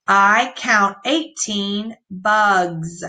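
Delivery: slow, word-by-word reading; slow read-aloud. Shown as a demonstration. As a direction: read it slowly for the class. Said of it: "They have an S at the end".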